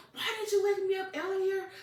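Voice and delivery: high, frantic anime-style protest; mock overreaction. Retelling the anime boy caught asleep, mock overreaction. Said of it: "He overreacts and he's like".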